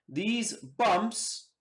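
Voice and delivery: ordinary talk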